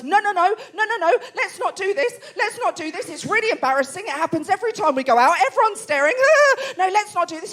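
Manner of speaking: high, pleading, a little breathless; a hint of an embarrassed Englishman begging; flustered husband. Comic English husband pleading with the family, lightly flustered. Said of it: "My husband stands up and goes".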